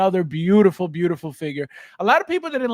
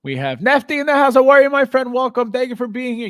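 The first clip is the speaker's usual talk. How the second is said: excited greeting; 4 semitones higher, 6 dB louder, faster than usual. Real, not acted. Greeting a viewer joining stream.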